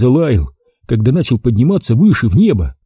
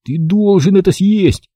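higher-pitched urgent command; ordering oneself; 8 semitones higher than usual. A narrator's reading. Boy orders himself to eat dough, commanding. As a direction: give yourself a firm, high-pitched order. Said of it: "приказал я себе".

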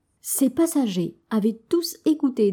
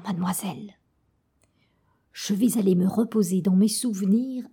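murmuring; 2 semitones lower than usual. Acted. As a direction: murmur it into her ear. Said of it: "murmura à son oreille".

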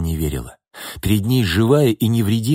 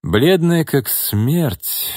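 usual speaking voice

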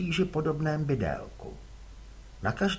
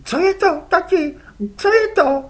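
put-on much higher boy's voice; a boy asking innocently; curious boy; 9 dB louder than usual. Les asks his father whose squid they are, boyish.

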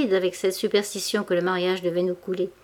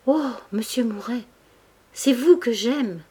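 slow, higher, stammering sob; sobbing confession; 3 semitones higher than usual. A narrator's reading. Denise confesses love to Mouret, sobbing. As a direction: break down as you confess your love. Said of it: "sanglota elle aussi en bégayant".

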